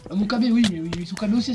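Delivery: normal talking voice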